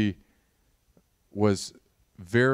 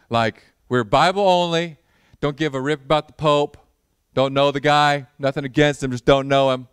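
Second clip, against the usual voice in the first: loud, cheeky, half-joking; hammed-up defiance at grandma; mock defiant declaration; 5 semitones higher, 8 dB louder than usual. Teasing grandma about Protestant church.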